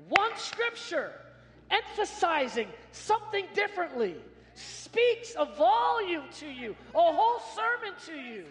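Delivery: loud, high, slow preacher's shout; full-force preaching. Acted. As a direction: preach it out, building to a roar.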